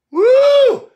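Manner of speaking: high, loud whoop; preacher's whoop. Real, not acted. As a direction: let out a loud, high shout of joy. Preacher whoops mid-sermon.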